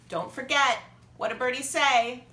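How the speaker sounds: usual speaking voice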